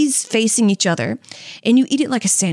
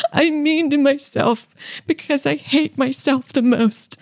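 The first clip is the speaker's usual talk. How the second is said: higher, slow self-pitying whine; sad sack voice; 4 semitones higher, slower than usual. Retold or imitated. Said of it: "imagine, like".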